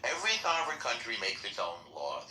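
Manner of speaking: usual speaking voice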